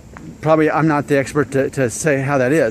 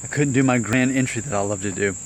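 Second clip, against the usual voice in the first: ordinary delivery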